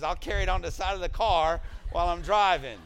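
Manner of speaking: loud, high, defiant retort; imitated determination. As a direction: play her stubborn comeback at full volume. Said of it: "That's her determination".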